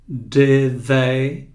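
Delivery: careful th in "Did they?"; tongue between teeth. Shown as a demonstration. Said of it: "a língua entre os dentes".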